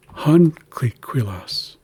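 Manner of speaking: "huncliquilas" with HUN stressed, slowly; stress on first syllable. Shown as a demonstration. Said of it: "placing emphasis in the word".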